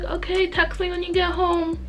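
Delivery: high, sweet goodbye. Retold or imitated. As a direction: sing it out lightly. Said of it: "I'm just like".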